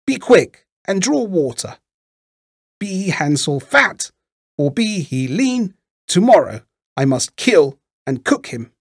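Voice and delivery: high-pitched witch giving orders; impatient witch crying out. A narrator's reading. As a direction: order the girl about, high and menacing. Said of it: "cried she to the little girl".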